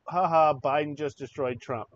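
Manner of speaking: loud mock gloating; mocking read-out. Host reading out Little Sis's post, loud mock triumph. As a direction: crow it loudly in mockery.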